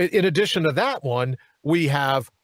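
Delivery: normal talking voice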